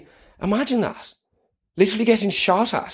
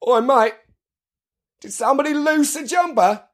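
put-on English accent; 9 semitones higher than usual. British soldier voice.

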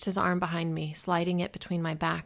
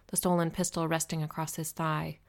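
ordinary delivery, nothing marked

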